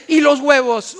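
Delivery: a wife demanding where the eggs are; reproachful wife. Retold or imitated. Wife asks husband about the forgotten eggs, reproachful.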